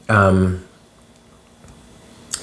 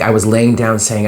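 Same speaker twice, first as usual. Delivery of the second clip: ordinary talk, nothing marked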